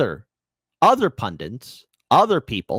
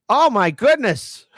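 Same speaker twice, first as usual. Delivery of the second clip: mock disbelief; 9 semitones higher, 5 dB louder, slower than usual. Real, not acted. Mock disbelief at the healthcare push.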